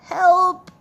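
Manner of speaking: usual speaking voice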